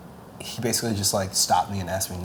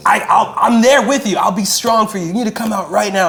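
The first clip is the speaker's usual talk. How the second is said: higher, louder insistent push to come out; hammed-up drunken egging on; urging shout; 10 semitones higher, 8 dB louder than usual. Friend at the barbecue urging him to come out, imitated loud and high.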